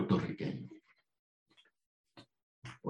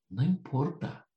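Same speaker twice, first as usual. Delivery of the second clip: usual speaking voice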